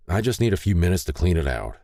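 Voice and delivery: ordinary delivery, nothing marked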